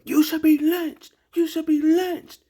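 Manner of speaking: high, loud, strained squeal of a line; imitating the man losing control, shrieking it; mock shrill yell. Commentator mocking the politician's outburst, shrill mock yell. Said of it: "He can't control it no more".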